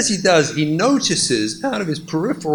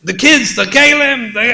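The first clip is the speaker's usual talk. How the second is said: high loud panicked blurting; mock panic, high and loud; frantic husband; 10 semitones higher, 7 dB louder than usual.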